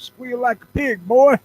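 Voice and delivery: high, loud backwoods menace; hammed-up Deliverance villain line; movie hillbilly quote. Quoting the movie to his cohost in a hillbilly voice. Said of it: "Ned Beatty impersonation".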